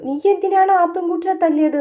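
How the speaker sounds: a light grandma voice, demanding; reproachful grandmother. Retold or imitated. Grandmother asking Vaijayanthi why she hit the girl.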